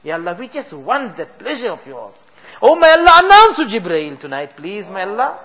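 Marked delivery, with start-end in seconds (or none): 2.6-5.4 higher and louder, crying out to God; fervent loud pleading. Real, not acted. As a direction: let the plea swell into a passionate cry. Climax of dua, impassioned crying out.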